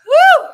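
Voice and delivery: high, loud woo; excited whoop. Real, not acted. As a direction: let out a triumphant cheer. Cheering after the chant.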